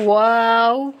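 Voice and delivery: واو drawn out to a rounded "oo"; sustained, rounded واو; well-rounded lips. Shown as a demonstration. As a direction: purse the lips fully on واو. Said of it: "ہونٹوں کو اچھے سے گول کرنا ہے".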